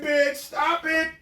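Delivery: usual speaking voice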